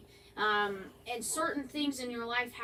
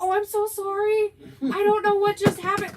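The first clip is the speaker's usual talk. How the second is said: high, loud flustered sorry; flustered apology; 9 semitones higher, 11 dB louder than usual. Retold or imitated. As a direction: blurt out a startled apology. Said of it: "And I'm like".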